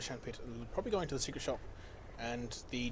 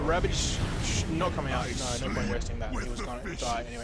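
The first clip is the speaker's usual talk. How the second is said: loud, fast-building casting call of the fight; hyped live esports commentary; excited play-by-play; 10 dB louder than usual. Acted. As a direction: ride the action as it unfolds, loud.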